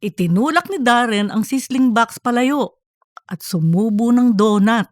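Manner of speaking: ordinary delivery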